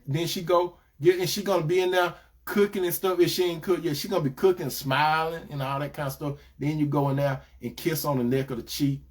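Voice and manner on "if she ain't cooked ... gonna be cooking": ordinary talk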